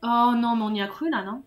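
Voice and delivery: loud groan of disappointment; dismayed outcry. Real, not acted. Player failing the jump, dismayed outcry. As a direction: break off with a loud cry. Fails swing mid-sentence.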